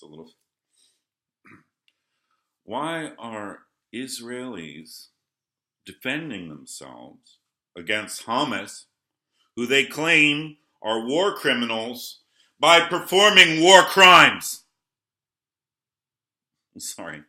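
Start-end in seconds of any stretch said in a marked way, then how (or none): 2.6-14.6 real anger rising to a shout; getting worked up. Real, not acted. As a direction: build from measured to outraged. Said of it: "I get a little worked up".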